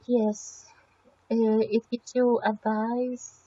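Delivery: ordinary talk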